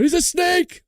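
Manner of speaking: suddenly high-pitched playful shout; mock alarmed cry. Streamer warning the chat about Cindy, mock alarmed cry. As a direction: jump way up in pitch, hammed-up warning. Repeats chat greeting, hammed up.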